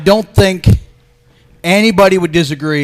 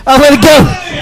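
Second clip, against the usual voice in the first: laughing; 7 semitones higher, much slower than usual. Real, not acted. Reacting laughing to the drink.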